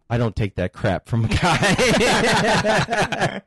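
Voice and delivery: slow, high mock-tough retort, laughing through it; wife throwing iced tea at her husband, slow high mock-tough voice. Wife scolding husband after iced tea, hammed-up slow and high. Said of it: "and she goes".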